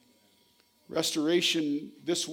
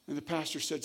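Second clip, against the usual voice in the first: ordinary delivery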